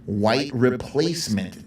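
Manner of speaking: ordinary talk, nothing marked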